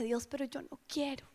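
soft reluctant prayer; hushed plea. Retold or imitated. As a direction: confess it quietly to God. Intimate plea to God.

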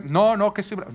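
high, loud mock pout; sulky child. Son complaining to his father after the spanking, high and sulky. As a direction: whine it like an offended boy. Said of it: "él viene".

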